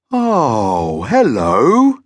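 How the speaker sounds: big friendly monster voice, swooping up. A narrator's reading. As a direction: greet a visitor as a genial monster. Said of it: "said the monster in a friendly voice".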